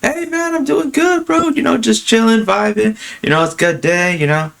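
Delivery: very high, chilled-out bro voice; matching bro energy. Retold or imitated. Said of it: "just keep that same energy".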